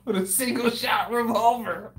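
talking through laughter; laughing. Real, not acted. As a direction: squeeze the words out laughing. Laughing at the scene.

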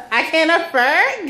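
giggling through the punchline; laughing. Real, not acted. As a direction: laugh at your own joke. Laughing at own pun.

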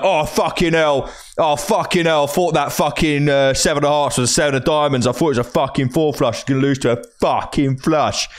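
hammed-up exasperated grumbling; mimicked whining complaint. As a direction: moan like a sore loser. Mimics sore loser's complaint.

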